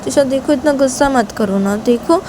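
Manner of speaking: high, drawn-out coaxing; acted wheedling appeal; pleading. A narrator's reading. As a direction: plead to be heard, stretching the words. Shanaya coaxes angry Tisha to listen.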